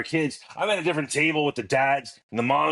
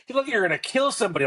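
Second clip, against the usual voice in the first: imitating startled parent; 12 semitones higher, much faster than usual. Other parent teasing at light.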